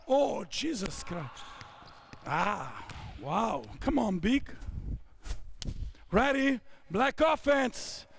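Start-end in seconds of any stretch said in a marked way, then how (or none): none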